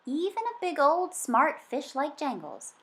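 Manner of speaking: plain reading voice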